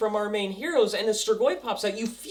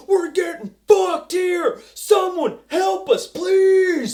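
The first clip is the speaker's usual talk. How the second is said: much higher, louder, slower panicked cry for help; desperate yell; 9 semitones higher, 7 dB louder, slower than usual. Retold or imitated. Soldiers calling over the radio, panicked yelling. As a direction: shout it like soldiers pinned down.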